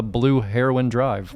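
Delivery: "Heroin" in three syllables, like the drug; mispronunciation. Retold or imitated. Host quoting residents' mistake, slow "Heroin" like the drug. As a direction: slowly say "Heroin" with an -oh-in ending. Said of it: "People say".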